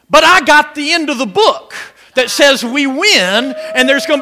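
loud, high, fervent preaching; preaching at full force. Real, not acted. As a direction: shout the good news to the church.

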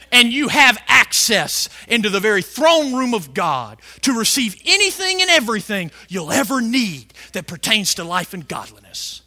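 usual speaking voice

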